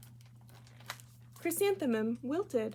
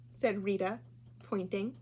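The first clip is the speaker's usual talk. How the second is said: plain reading voice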